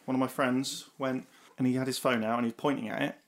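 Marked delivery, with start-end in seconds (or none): none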